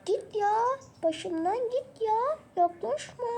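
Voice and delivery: put-on high-pitched girl shooing him away; girl brushing off. Merve rejecting him, high girl voice. (erkeği başından savan kız, tiz ses)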